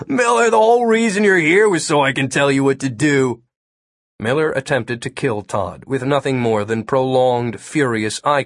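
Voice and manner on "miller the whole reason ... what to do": put-on high, scoffing kid voice; snort, then lecture him in a raised voice; snorting derision. A narrator's reading. Kid telling the agent why he's there, derisive. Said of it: "Todd snorted again".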